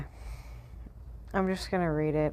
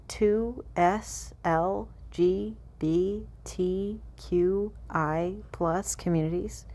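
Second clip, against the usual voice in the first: drawn-out mock reading; 3 semitones higher, much slower than usual. Reading an unwieldy government acronym to viewers, slow and mock-earnest. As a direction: spell it out laboriously, each letter rising. Mocking readout of fact sheet acronym.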